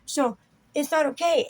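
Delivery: ordinary talk, nothing marked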